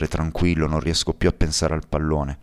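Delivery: ordinary delivery, nothing marked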